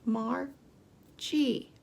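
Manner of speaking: Margie split into two separate beats; slow, separated Margie for counting; syllable by syllable. Shown as a demonstration. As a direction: pause between the syllables of Margie. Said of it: "Let's count the syllables".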